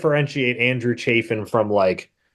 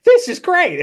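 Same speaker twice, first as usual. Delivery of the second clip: sharply higher, louder gush of delight; excited; 5 dB louder, slower than usual. Retold or imitated. Host recalls loving the guest's podcast, excited mock gush. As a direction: gush like you just found a gem.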